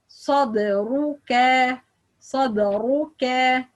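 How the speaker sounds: slow sadruka, heavy start and light ka; correct light kaf. Shown as a demonstration. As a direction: end sadruka on a thin "keh". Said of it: "light letter".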